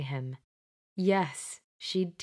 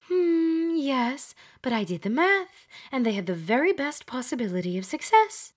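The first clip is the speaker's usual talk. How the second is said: indignant Glenda; 6 semitones higher than usual. Acted. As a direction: put her in her place, she did the math. Glenda defends her math.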